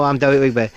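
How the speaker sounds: W for R in DEREK; Jonathan Ross speech impediment. Retold or imitated. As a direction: introduce yourself as DEREK with the R turned to W, "Dewek". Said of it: "did a Jonathan Ross thing".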